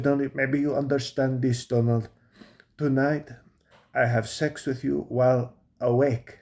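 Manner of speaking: put-on thick European accent; wife's foreign accent. Wife offers husband a night together, mock foreign accent. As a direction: imitate a foreign-born wife's accented English. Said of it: "the accent doesn't go away".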